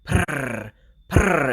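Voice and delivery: rolled purr back at the cat; imitated purring. Purring to make the cat feel comfortable, trilled purr. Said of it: "I should stop purring as well".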